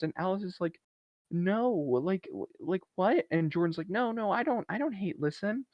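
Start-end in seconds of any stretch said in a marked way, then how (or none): 1.3-3.3 high-pitched, halting mock denial; defensive denial. Alice caught off guard in an argument, defensive denial. 3.9-5.7 high, soft, hurried-to-deny voice; mock backpedalling. Jordan denying she hate-listens to the podcast, mock backpedalling.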